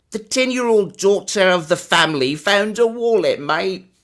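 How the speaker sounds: high, loud, self-mocking Scottish accent. Shown as a demonstration. Streamer reads the yearbook story in a bad Scottish accent. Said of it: "It's so bad".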